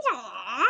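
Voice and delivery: loud swooping "wah" mimicking Kabuki actors; exaggerated mock stage wail; imitated Kabuki cry. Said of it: "hearing Japanese people actually say".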